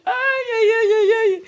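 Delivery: playful high-pitched lament; mock dismay. Real, not acted. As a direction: squeal it out in amused disbelief. Host mock dismay, laughing.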